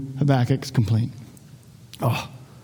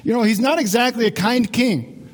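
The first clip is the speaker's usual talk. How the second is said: ordinary talk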